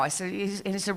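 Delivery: ordinary talk, nothing marked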